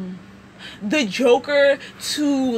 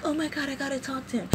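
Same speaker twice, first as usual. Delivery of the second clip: nervous hush; 10 dB quieter, faster than usual. Retold or imitated. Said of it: "and she's like".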